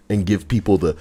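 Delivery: usual speaking voice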